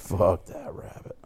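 slow hushed whisper; whispered echo. Pretend. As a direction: echo it back under your breath. Echoing the whispered curse.

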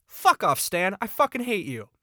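loud, high-pitched cursing; exasperated outburst. Real, not acted. Reviewer cursing the audiobook narrator, exasperated outburst. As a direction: lash out at the character you can't stand.

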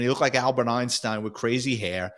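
normal talking voice

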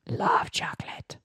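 whisper. Acted. Playful whispered slogan.

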